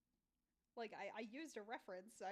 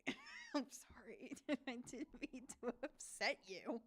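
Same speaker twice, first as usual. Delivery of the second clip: retold apology broken by laughs; laughing apology; 3 semitones higher, 4 dB louder than usual. Streamer retelling her apology to her sister, laughing. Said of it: "And I was like".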